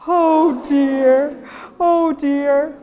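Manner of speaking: thin fold voice. Shown as a demonstration. Teacher showing the group the thin-fold sound. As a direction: lift it into a high, sobbing "Oh dear". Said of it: "So say that all for me".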